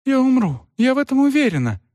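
high, fast, frightened cry; panicked. Acted. A young mother panicking to the aunt, high and fast. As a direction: she is sure she will die, panicking. Terrified mother fears dying.

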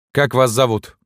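gruff but kind. A narrator's reading. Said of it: "солдатски грубоватым голосом ... доброта".

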